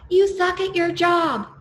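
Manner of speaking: heckler insult. Retold or imitated. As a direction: drag out the insult to wound. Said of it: "he said some things".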